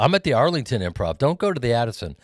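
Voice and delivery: rising, fast, eager show pitch; excited plug. Real, not acted.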